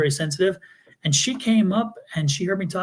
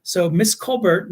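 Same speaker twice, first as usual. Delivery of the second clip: ordinary delivery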